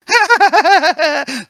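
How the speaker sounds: very high, loud cackle; high shrieking laugh. Acted. Spring laughing as Otto falls, shrill and loud. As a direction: shriek with glee. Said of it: "Laughs Spring".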